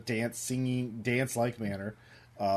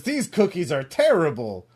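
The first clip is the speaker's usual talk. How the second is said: loud, high cartoon outrage; hammed-up disgusted villain; mock-indignant food complaint, pitched up; Mister Cat; 6 semitones higher, 7 dB louder than usual. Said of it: "he says".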